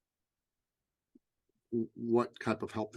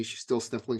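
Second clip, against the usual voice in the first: ordinary talk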